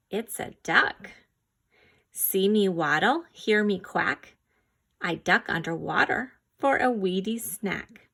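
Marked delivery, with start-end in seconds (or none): none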